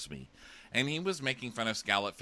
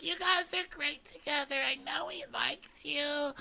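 Crystal. Retold or imitated. Crystal reassures Annalise he likes her, squeaky mock gushing. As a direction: squeal encouragement in a put-on falsetto. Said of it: "And Crystal's like".

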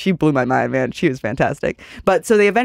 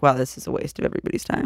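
fast, soft whispered aside; 4 semitones lower, 7 dB quieter, faster than usual. Retold or imitated. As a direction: mutter it under your breath, fed up. Inner thought during the fight.

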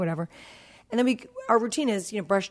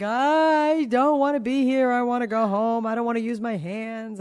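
imitating crying child; 3 semitones higher than usual. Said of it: "She's crying".

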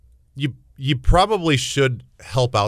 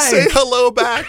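higher, laughing through the words; laughing punchline; 11 semitones higher, 4 dB louder, slower than usual. Real, not acted. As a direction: crack up as you deliver the joke. Cohost adds punchline laughing.

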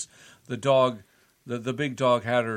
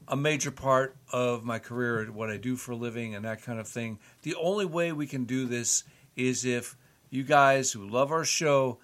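ordinary talk

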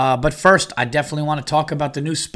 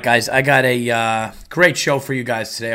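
ordinary talk, nothing marked

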